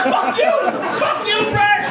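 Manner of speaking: high, loud yelled insult; angry yell. Acted. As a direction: shout it back at him. Yelling back at bully.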